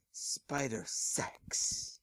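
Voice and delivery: very slow, drawn-out whisper; slow eager whisper. Acted. Big Mac Spider agreeing eagerly, slow whisper. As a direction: savour the words in a hushed whisper.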